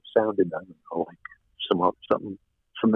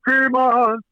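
very high put-on singer's voice; high, sustained mock singing voice; Steven's other voice. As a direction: imitate a rock singer, pitched way up on held notes. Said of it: "he had this other voice".

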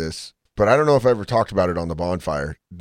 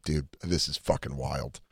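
muttered aside; 4 semitones lower, 7 dB quieter than usual. Retold or imitated. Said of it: "And I was like".

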